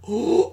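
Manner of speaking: high, loud "oh" of awe; awed gasp. Retold or imitated. Reliving a glimpse of Godzilla in trailer, awed gasp. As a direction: gasp as if stunned by the sight.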